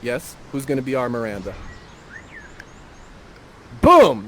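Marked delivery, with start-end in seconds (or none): 3.8-4.3 sudden loud "Boom!" to crown the choice. Real, not acted. Host announcing the choice to the players, loud burst. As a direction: slam it out like a reveal.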